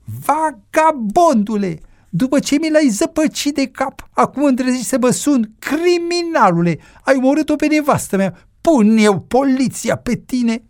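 high-pitched furious tirade; explosion of rage. A narrator's reading. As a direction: hurl accusations in a high, strained voice. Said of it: "Îngrozit de explozia de mânie".

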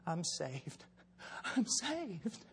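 starts hushed, builds to high, loud elation; acted astonished new convert, rising to a cry; dawning joy. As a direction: let the realisation burst out step by step. Acts out man realising he is saved.

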